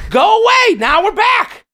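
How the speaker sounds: high, loud call to shoo someone off; mock dismissal. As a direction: send the heckler off with a high shout. Replays dismissing the heckler after sparring.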